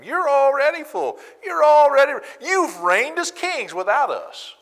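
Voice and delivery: high, loud sneering put-on voice; mock their fullness like a taunting child; sarcastic mockery. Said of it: "he's getting sarcastic with them".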